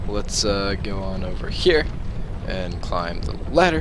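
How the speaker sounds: exaggerated upward swoop on "here" and "ladder". Shown as a demonstration. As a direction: pitch jumping up on "here" and "ladder". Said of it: "raising my voice at the end of sentences".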